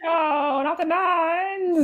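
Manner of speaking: high, slow mock lament; mock dismay. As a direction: wail it in playful horror, drawn out. Mock horror at the nuns story.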